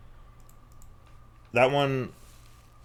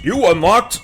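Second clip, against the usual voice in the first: ordinary talk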